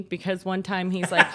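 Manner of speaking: ordinary delivery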